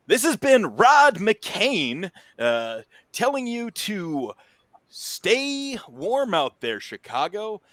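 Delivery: slow, high, loud late-night radio host. Acted. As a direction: croon it like a DJ signing off over the airwaves. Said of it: "you can hear a voice coming through".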